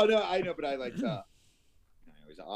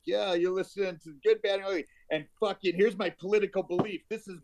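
mock podcast host; 8 semitones higher, 4 dB louder than usual. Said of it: "am I thinking it's gonna be like".

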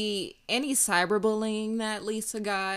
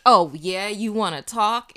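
sassy challenge; 9 dB louder than usual. Retold or imitated. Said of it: "the way she pulled it out".

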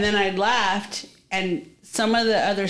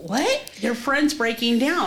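high "what?", then a rising echo; retold disbelieving question; 3 semitones higher, slower than usual. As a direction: imitate taking in startling news, pitch rising. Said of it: "And I go".

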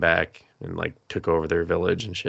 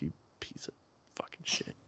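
whispered mock insult; muttered insult; 17 dB quieter than usual. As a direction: mutter it under your breath, barely voiced. Insult added under the breath.

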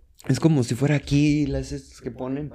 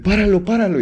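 high loud stop it, stop it; urgent plea; 8 semitones higher, 5 dB louder than usual. Retold or imitated. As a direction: beg them to stop the ride, high and urgent. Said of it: "Y yo".

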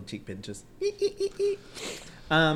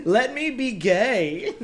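drawn-out pleading; slow dramatic plea; 6 semitones higher, much slower than usual. Pretend. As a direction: stretch it out like a plea.

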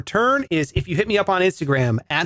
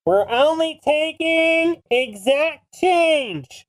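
high, drawn-out public announcement; announcing to a crowd; 9 semitones higher, much slower than usual. Retold or imitated. Ice cream kid addressing the whole waiting line, announcing. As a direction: call it out slowly and high to everyone. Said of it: "announces to the whole crowd".

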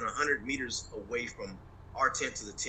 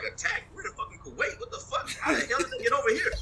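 stunned disbelief; 13 semitones higher than usual. Retold or imitated. To the host, retelling his reaction to the attack order: high, stunned disbelief. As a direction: voice jumps much higher, disbelieving, hurried at the end. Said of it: "I was like".

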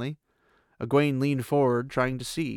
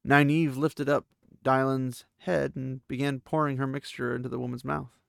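ordinary delivery

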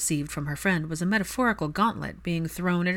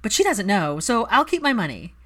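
smirking; 4 semitones higher, 6 dB louder than usual. A narrator's reading. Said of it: "Pansy smirked".

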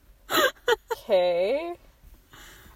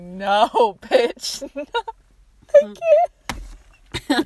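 mock protest from her own eye, half-laughing; voicing her eye; 5 semitones higher, 10 dB louder, slower than usual. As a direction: play the stubborn eye refusing. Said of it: "It's like".

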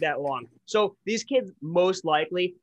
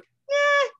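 high, sneering mock voice of the media. Mocking the media's excuse, high and sneering. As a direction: pitch it way up like a dismissive newsroom. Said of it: "they're like".

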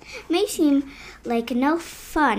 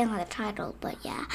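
whisper; 4 semitones lower, 12 dB quieter than usual. Real, not acted. Aside about the title.

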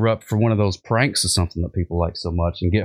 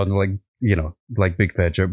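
ordinary talk

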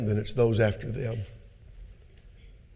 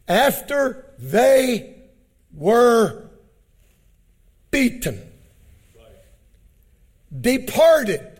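slow, rising preacher cry; building preach; 11 semitones higher, 5 dB louder, much slower than usual. Acted. Preacher lifting the crowd to the disciples' joy. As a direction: stretch it out and climb higher.

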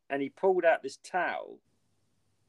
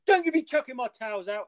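retold scolding; 9 semitones higher, 3 dB louder than usual. Retold girl scolds towel thrower.

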